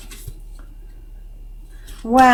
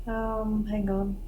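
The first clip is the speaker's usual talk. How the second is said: whisper; 12 dB quieter than usual. Real, not acted. Fussing quietly with camera.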